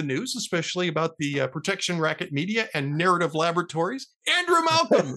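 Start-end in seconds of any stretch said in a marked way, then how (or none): 4.3-5.2 high, ringing name announcement; playful ring-announcer introduction; announcer flourish. Pretend. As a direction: announce the guest like a star. Host's grand sign-off of guest.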